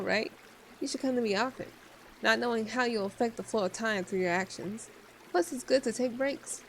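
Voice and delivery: usual speaking voice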